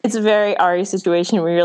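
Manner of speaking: ordinary talk, nothing marked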